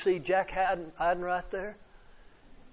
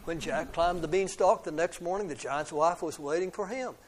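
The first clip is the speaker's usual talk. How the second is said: ordinary delivery, nothing marked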